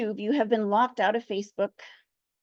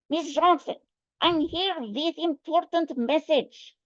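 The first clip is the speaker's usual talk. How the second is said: put-on Russian accent; 3 semitones higher, slower than usual. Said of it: "better Russian accent".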